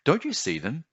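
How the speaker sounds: put-on child voice, pitched high and hesitant; young boy. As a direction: sound like a puzzled small boy. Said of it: "the boy asked".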